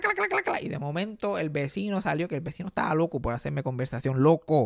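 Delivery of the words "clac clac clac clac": high, rapid clacking sounds; chipping ice. Retold or imitated. Chipping ice off the car, clacking high. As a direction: rattle off the chips like a scraper. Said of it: "Y empecé, clac, clac".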